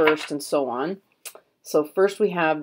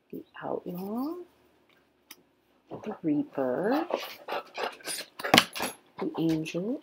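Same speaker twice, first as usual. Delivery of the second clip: ordinary talk, nothing marked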